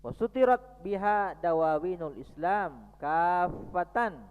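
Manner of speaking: chanted classical recitation, unhurried. Acted.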